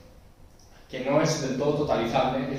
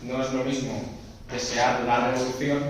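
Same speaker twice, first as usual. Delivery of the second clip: usual speaking voice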